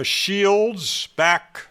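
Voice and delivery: ordinary talk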